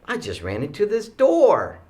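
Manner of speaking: loud, high mock yelp of pain; yelling out. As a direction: imitate a hurt kid yelling. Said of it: "the kid yells out".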